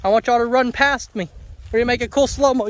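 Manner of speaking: normal talking voice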